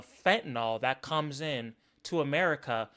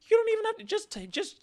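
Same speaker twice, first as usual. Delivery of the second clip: exasperated high outburst; faster than usual. Real, not acted. Exasperated rant.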